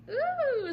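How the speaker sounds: high, loud happy coo; delighted ooh. Retold or imitated. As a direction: coo it, very pleased. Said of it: "I got so freaking happy".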